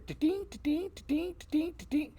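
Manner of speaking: high, repeated mouth-made ding; imitated achievement chime. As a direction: mimic game alerts pinging in a high pitch. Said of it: "in my headset, I hear".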